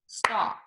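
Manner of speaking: star clapped once; clapped syllable. Shown as a demonstration. Teacher clapping out one syllable. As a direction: clap star as one beat. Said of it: "if I say it and clap it".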